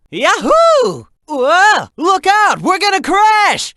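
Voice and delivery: high, loud whoops turning to alarm; excited yelling. Acted. Sidekick yelling to Shadow as they crash, high excited shouting. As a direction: yell it like you're on a runaway ride. Wild ride, about to crash.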